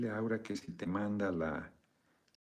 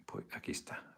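whisper; 11 dB quieter than usual. Real, not acted. Whispers before showing the horse.

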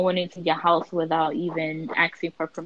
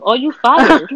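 mock yell; 3 semitones higher, 8 dB louder, slower than usual. Mock firing the Trump supporter.

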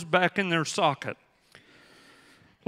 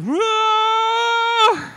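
long, much higher, louder yell of pain; pained shout; 8 dB louder than usual. Retold or imitated. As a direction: cry out as the arm is yanked. Pulled apart by doctor, cusses.

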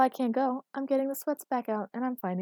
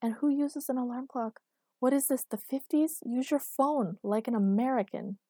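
higher-pitched, indignant questioning; accusatory; 6 semitones higher, 3 dB louder than usual. A narrator's reading. Irritated girlfriend faults his alarm clock, accusatory. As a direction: take him to task for his habits. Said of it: "glaring at him accusatorily".

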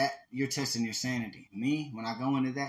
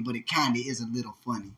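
normal talking voice

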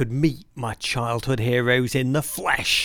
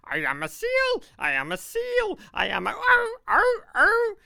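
very high-pitched barking seal impression; screeching seal. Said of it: "making a screeching sound".

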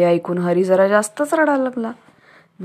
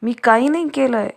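high, loud pleading yell; a boy yelling for his father to let go of his ear; crying out in pain; 5 semitones higher, 4 dB louder than usual. Acted. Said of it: "Hari लागला ओरडायला". (मोठ्याने ओरडत विनवणी)

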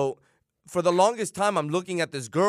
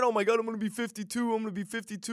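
mocking panicked friend; 7 semitones higher, 4 dB quieter, faster than usual. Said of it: "you start putting into it".

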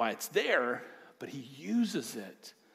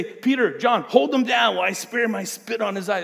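loud, raised joking order; mock command; 6 semitones higher, 10 dB louder than usual. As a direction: bark it for laughs. Imagined joking instruction from Jesus.

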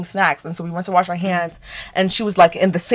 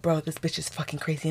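much softer, under-the-breath remark; muttered aside; 3 semitones lower, 14 dB quieter than usual. Retold or imitated. Reacting to roommate's random screaming, muttered aside. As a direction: mutter it privately to yourself. Retold private thought about roommate.

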